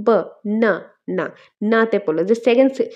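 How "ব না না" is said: ব-না-না very slowly, with gaps between the three syllables. Shown as a demonstration. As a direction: isolate each beat of ব-না-না to test for stress. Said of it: "প্রেশারটা কোথায় পড়লো".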